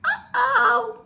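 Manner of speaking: high, loud pigeon uh-oh. Acted. Pigeon knows the driver doesn't want him around, high uh-oh. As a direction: squeal it as if caught. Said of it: "And the pigeon goes".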